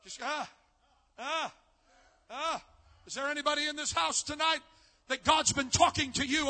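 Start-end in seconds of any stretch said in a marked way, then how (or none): none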